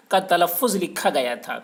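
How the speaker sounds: ordinary delivery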